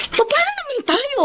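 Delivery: exasperated outburst. Acted. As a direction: vent the unfairness in a rising pitch. Venting about the toxic boss.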